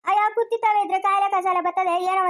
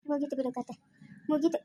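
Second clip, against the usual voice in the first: despairing mutter; 3 semitones lower, 8 dB quieter than usual. Acted. Despairing aside about daughter.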